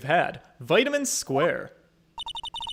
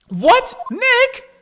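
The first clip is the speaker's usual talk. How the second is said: loud, much higher shocked call; alarmed high yelp; 6 dB louder than usual. Acted. The partner calls out to Nick, high and startled. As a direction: cry out his name in alarm. Voicing game character, alarmed.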